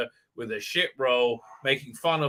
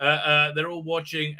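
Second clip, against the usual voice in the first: normal talking voice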